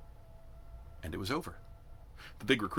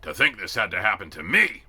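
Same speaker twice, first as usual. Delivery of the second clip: loud lament to himself; voice that carried; 8 dB louder than usual. A narrator's reading. Sergeant laments to himself before the recruits, loud. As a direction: groan it loud enough to carry. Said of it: "he had a voice that carried".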